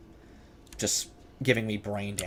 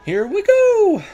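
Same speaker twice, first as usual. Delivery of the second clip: high, loud burst of nerve; excited; 9 dB louder, slower than usual. Real, not acted. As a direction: psyche yourself up and go. Starting a risky run.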